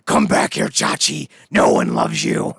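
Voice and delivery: loud, rough killer-clown bellow; hammed-up monster shouting; Sweet Tooth. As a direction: roar after someone running away. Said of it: "Sweet Tooth is all like".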